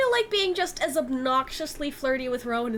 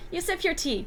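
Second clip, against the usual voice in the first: usual speaking voice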